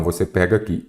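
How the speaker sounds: ordinary talk, nothing marked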